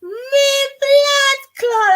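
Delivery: loud Jamaican exclamation, drawn out; Jamaican accent. Acted. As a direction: stretch it out in a hammed-up Jamaican accent. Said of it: "you have to prime the accent".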